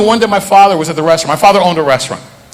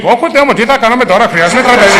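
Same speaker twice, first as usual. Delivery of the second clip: put-on high, caricatured Pakistani accent in Greek; 6 semitones higher than usual. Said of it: "having a Pakistani go talking Greek".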